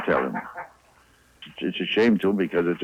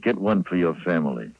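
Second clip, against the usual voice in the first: normal talking voice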